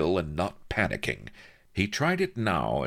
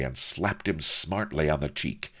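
plain reading voice

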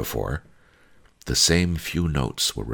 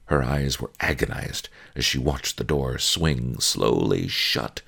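ordinary delivery

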